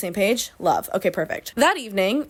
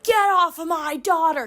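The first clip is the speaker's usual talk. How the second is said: a raised, higher mock yell; dad yelling at the boy on his daughter; angry dad; 7 semitones higher than usual. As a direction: bark it like a furious father. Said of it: "And he's like".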